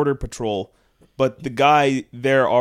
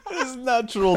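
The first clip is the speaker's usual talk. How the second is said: laughing through the words, higher and louder; 10 semitones higher, 7 dB louder than usual. Real, not acted. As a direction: crack up while calling it podcast gold. Laughing at own blooper.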